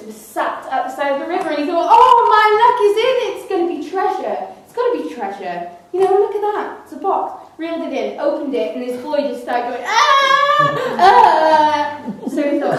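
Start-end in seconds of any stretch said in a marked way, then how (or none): 1.9-7.4 much higher, gleeful treasure hunter; excited apprentice. Acted. Apprentice spots treasure box. 9.9-12.0 high louder mock baby cry; baby wail. Baby cries in the box.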